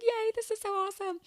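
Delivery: high, squealed little cheer; excited cheer. Retold or imitated. Podcaster recalling her reaction to the trip, excited high cheer. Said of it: "And so I was like".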